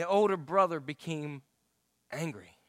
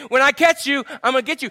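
mock chase threat; 6 semitones higher, 8 dB louder than usual.

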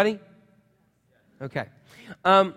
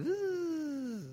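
high whining zzz of wheels spinning; tire spin noise; 11 semitones higher, 11 dB quieter than usual. Retold or imitated. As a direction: imitate the spinning tires. Car wheels spinning in mud.

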